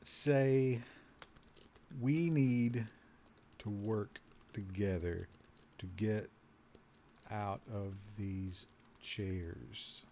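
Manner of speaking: slow, low, soft and spaced out; slow dictation. Real, not acted. Player typing a plan to fellow prisoners, slow soft dictation. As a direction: murmur each word as you type it. Speaking slowly while typing input.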